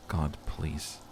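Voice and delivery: quiet plea. A narrator's reading. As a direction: barely breathe the plea to God. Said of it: "He quietly utters".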